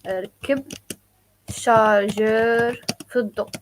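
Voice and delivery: "ركب" then "شارجر في الضوء" split with pauses; slow, chunked for learners; slow teaching demo. Shown as a demonstration. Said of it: "So ركب".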